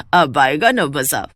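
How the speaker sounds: slow low scheming cackle; villainous gloat. Acted. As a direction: savor the revenge, slowly. Mother-in-law's evil revenge. (धीमी, कुटिल हँसी के साथ)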